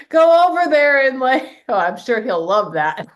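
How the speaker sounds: amused about the husband fixing the dryer, laughing; laughing while talking. Real, not acted. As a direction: keep laughing as you speak.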